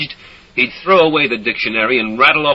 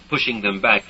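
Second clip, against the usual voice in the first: ordinary delivery, nothing marked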